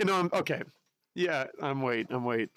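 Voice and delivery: ordinary talk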